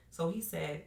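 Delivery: ordinary talk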